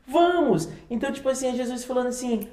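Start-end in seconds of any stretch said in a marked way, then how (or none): 0.1-0.7 disciples agreeing. Retold or imitated. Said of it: "E os discípulos".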